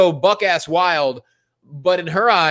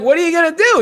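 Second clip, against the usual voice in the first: mock panicked boyfriend; 13 semitones higher, 5 dB louder, faster than usual. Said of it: "I'm like".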